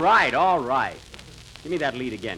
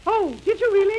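raised pitch, half-way to a woman's voice; higher, as a woman; 6 semitones higher than usual. Acted. Actor retrying his line as a woman, higher. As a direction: lift your voice toward a woman's. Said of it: "Hiya, [name], you're a woman".

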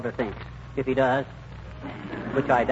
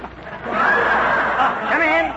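high call to the door; 11 semitones higher than usual. Acted. Husband calls to the visitor at the door, calling out high.

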